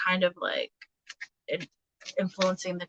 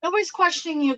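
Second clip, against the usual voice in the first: high, sing-song pet voice; talking to the cat; 9 semitones higher, 3 dB louder, faster than usual. Real, not acted. Owner teasing her meowing cat, high pet voice.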